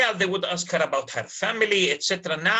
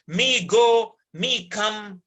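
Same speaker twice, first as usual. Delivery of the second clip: broken pidgin English; 5 semitones higher, 5 dB louder, slower than usual. Shown as a demonstration. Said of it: "Pidgin English broken".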